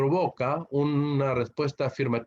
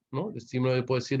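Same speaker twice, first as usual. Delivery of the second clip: ordinary delivery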